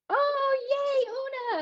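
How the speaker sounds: high squeal of delight; excited cheer. Real, not acted. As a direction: cheer them in, thrilled. Cheering as attendees arrive.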